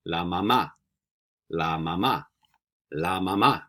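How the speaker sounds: "mamá" stressed on the last syllable, ma-MA; model pronunciation. Shown as a demonstration. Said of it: "I'll say it three times".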